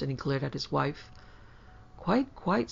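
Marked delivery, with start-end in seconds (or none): 2.0-2.7 higher, nervous little reply. A narrator's reading. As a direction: answer nervously, pitched up. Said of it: "said Laura nervously".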